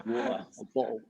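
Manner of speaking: "Water, a bottle" with swallowed T's, mock British; glottal-stop Britishism. Shown as a demonstration. Showing friends the British dropped T. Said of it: "pronounce your T's".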